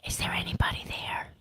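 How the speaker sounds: soft hushed whisper. Real, not acted. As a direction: whisper it under your breath. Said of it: "Why, why are we whispering?".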